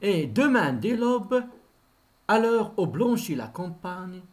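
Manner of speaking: declaimed line of poetry; verse recitation. Acted. Teacher showing pupils a single line of verse, declaimed. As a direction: launch the verse firmly, in two measured halves.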